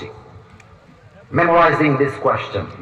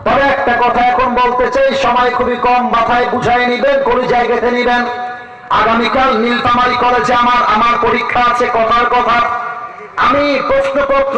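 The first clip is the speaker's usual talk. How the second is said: raised ringing sermon delivery; preaching; 9 semitones higher, 4 dB louder than usual. Acted. Preacher urges the youth to remember, ringing preaching. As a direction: lift the voice and drive the point home.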